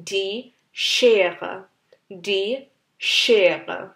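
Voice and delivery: Schere with a strong sh; heavy sch. Shown as a demonstration. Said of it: "আরেকটু ভর দিয়ে". (Schere এর শ জোর দিয়ে)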